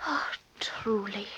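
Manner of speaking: breathless hushed whisper. Acted. Wife whispering her bitter reply to Robbie.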